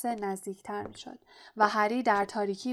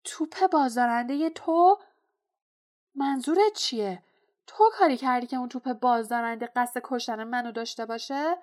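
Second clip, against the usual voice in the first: indignant, raised questioning; loud, high burst of outrage easing slightly; angry; 3 semitones higher, 5 dB louder than usual. A narrator's reading. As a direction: demand an answer angrily. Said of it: "هری بار دیگر خشمگین شد".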